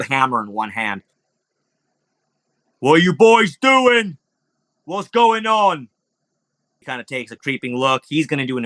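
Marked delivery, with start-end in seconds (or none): 2.8-5.9 high, drawn-out guard's holler; guard challenge. Acted. Game master as large guard confronting the boys, hollering. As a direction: bellow slowly at suspected troublemakers. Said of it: "This large kind of guard".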